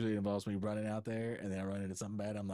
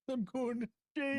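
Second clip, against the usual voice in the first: Viking voice; 13 semitones higher than usual. Pretend. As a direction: ham up a Viking warrior. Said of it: "you have to talk in a Viking voice".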